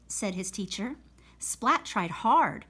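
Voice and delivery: plain reading voice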